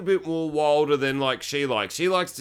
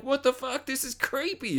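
high, softer freaked-out reaction; pitched-up playful unease; mock creeped-out; 10 semitones higher, 5 dB quieter than usual. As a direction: act creeped out, voice up and small. Acting out being creeped out.

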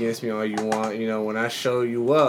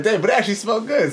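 surprised; 11 semitones higher, 6 dB louder, faster than usual. Retold or imitated. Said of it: "I was like".

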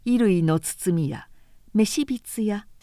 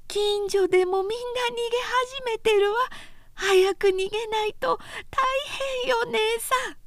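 put-on high, pleading girl's voice rising to a tearful cry; a panicky girl begging her sister to flee; frightened younger sister; 14 semitones higher than usual. A narrator's reading. Oyoshi urges her older sister to flee, high and tearful.

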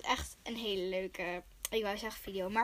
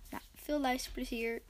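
ordinary talk, nothing marked

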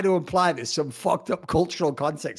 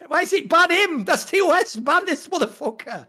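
mock outrage; 9 semitones higher than usual. Mock outrage at toxic player.

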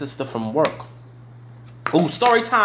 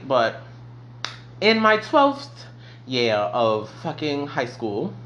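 ordinary delivery

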